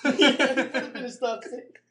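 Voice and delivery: high-pitched, amused through laughter; laughing. Real, not acted. Echoing a co-host's joke, laughing.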